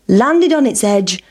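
usual speaking voice

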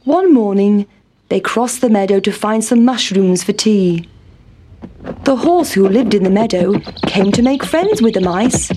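plain reading voice

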